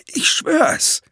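very high, desperate plea; frightened captive begging to be believed; panicked stammer. A narrator's reading. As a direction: swear it desperately, voice shooting up high. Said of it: "stammelte der Dreifinger".

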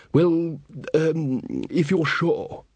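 fretful king trailing off; nervous young king. Acted. Verence voiced.

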